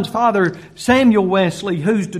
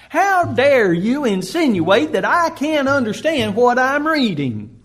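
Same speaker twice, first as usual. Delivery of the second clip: higher, drawn-out offended retort; mock indignation; 4 semitones higher than usual. Offended listener answering Philip's question, mock indignant. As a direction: act out taking offence, slow and higher.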